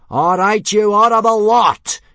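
very high, very loud bellow; drill-sergeant yell. A narrator's reading. Instructor to twenty boys before the test, bad-tempered yell. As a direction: bellow at a line of recruits. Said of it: "yelled Gobber".

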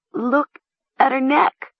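high, halting, horrified; broken, high-pitched alarm; stammering. A narrator's reading. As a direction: falter in horror between words. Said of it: "Mara stammered".

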